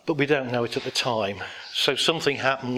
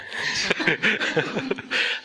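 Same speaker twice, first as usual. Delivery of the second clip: unvoiced chuckle; 5 semitones higher than usual. Real, not acted. Laughs at himself.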